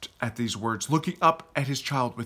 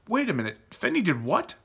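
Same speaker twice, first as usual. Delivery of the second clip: higher-pitched, mildly outraged interruption; pitched-up, understated rage; indignant outburst; 7 semitones higher, faster than usual. A narrator's reading. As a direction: cut in with indignant disbelief. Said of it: "Justin raged".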